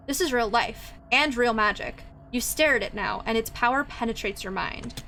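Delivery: steady storybook narration. A narrator's reading. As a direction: read it like the game narrator. Reads game narration.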